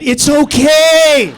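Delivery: loud, very slow, high drawn out; mock reassurance shout. Real, not acted. Comic mock-comforting the audience, shouted and slow. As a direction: stretch it out, mock soothing.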